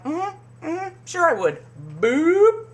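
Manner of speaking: sweetly sarcastic yes that means no; mock Heather. Voicing Heather's fake agreement.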